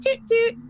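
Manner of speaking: mock tram toot. Imitating the tram horn.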